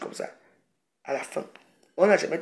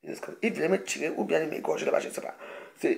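ordinary talk

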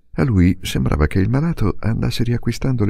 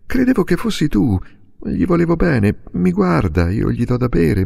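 put-on higher, tender pleading boy; torrent of pleading words; 7 semitones higher than usual. A narrator's reading. Boy begging his father to stay by the dying man, pleading. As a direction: beg your father to let you stay. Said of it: "un torrente di parole".

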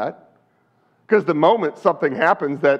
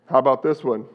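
usual speaking voice

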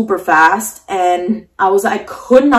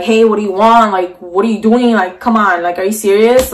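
usual speaking voice

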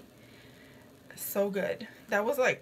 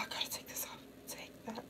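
whisper; 20 dB quieter, faster than usual. Real, not acted. Streamer whispering to herself while taking off her face mask.

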